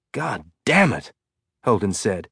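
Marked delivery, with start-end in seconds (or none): 0.1-1.1 slow, muttered frustration; slow exasperated swearing; frustrated curse. Acted. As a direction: let the frustration leak through each word. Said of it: "could hear the frustration in his voice".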